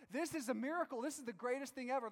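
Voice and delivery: thrilled shout of wonder; ecstatic crowd. Retold or imitated. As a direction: cheer it like you saw a miracle. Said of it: "They're like".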